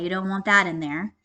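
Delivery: usual speaking voice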